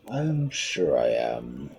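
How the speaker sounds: slow low shark. Acted. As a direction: drawl it out like a sure shark. Shark answers.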